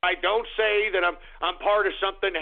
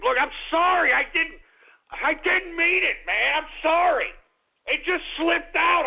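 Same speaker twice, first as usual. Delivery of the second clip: frantic apology; 8 semitones higher than usual. Acted.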